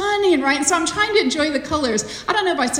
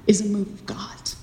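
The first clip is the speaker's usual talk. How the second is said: soft breathy whisper; 5 semitones lower, 6 dB quieter than usual. Real, not acted. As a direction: whisper it reverently. Whispered repeat of the phrase.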